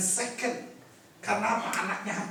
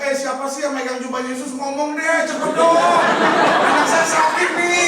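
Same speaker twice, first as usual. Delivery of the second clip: building from urgent to loud, high desperate pleading; impatient Jairus; 7 semitones higher, 11 dB louder than usual. Retold or imitated. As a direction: Jairus grows more frantic, pushing the crowd to own up. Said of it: "saya percaya Yairus mungkin bilang".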